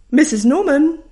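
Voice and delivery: teacher bellowing from a cave. Retold or imitated. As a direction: bellow the name across a bad line. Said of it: "bellowed Missus Kelvin".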